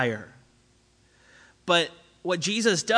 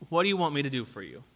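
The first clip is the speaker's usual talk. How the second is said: fast, softer rush of the question; fast, hushed repeat; quick follow-up question; 4 dB quieter, faster than usual. Retold or imitated. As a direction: rattle off the follow-up. Jesus pressing the disciples.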